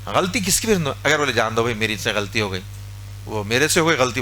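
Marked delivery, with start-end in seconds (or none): 1.3-4.2 low, quieter giving-in voice; a hushed peacemaker backing down; soft conciliating apology. Acted. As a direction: let it go gently, own the mistake. Conceding the fault in quarrel.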